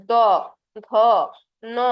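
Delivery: দ ধ ন said alone, tongue on teeth; teacher sounding dental letters দ ধ ন; dental consonant demo. Shown as a demonstration. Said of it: "এগুলা বলার সময়".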